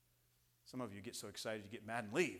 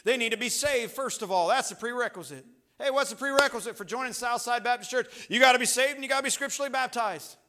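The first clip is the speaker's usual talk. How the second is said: loud, high-pitched pulpit delivery; urgent preacher at full volume; full-force preaching; 10 semitones higher, 12 dB louder than usual. Real, not acted.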